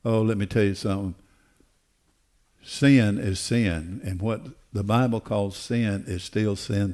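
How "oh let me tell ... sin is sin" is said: ordinary talk, nothing marked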